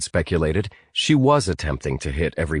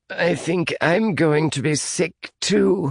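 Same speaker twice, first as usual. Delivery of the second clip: queasy Ian; 11 semitones higher, slower than usual. Acted. Ian voiced by narrator.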